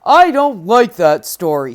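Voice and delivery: loud giant voice; a big voice, grumbling; sulky giant. Acted. Giant complaining to Jack, loud and grumpy. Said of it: "says the giant".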